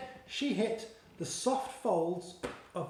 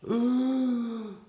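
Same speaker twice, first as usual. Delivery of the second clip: louder, higher frightened gasp; sharp gasp; 6 semitones higher, 5 dB louder than usual. A narrator's reading. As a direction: gasp in sudden fear. Teeth close near Sophie.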